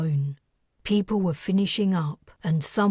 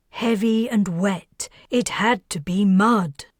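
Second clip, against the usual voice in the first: plain reading voice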